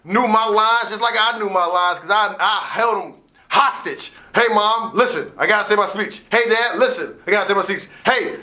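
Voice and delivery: ordinary talk, nothing marked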